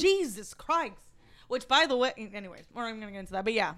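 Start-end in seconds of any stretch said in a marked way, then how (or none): 0.0-0.9 higher-pitched mock shock; shocked mom. Mom hearing her child swear, mock shocked. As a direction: exclaim it as the appalled mother. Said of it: "And the mom's like".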